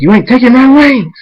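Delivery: loud, high yell rising on the wings; defiant shout. A narrator's reading. Hunter refusing to lose his wings, defiant shout. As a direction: yell your refusal at full voice.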